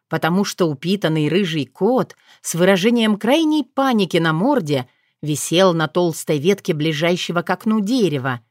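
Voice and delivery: usual speaking voice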